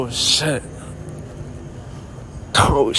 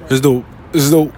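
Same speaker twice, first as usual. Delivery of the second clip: usual speaking voice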